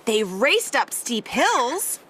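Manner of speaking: ordinary delivery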